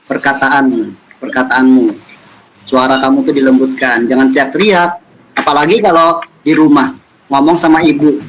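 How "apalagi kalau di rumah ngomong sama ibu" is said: normal talking voice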